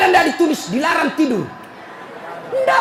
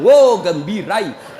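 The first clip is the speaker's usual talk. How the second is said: normal talking voice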